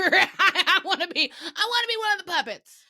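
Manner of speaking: high, laughing plea; hammed-up excited pleading; mock eager begging. Acts out begging to be a puppet.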